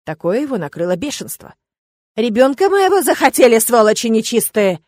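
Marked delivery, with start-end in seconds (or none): 2.2-4.8 inhuman roar. Acted. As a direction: roar like something possessed. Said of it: "Не своим голосом взревел он".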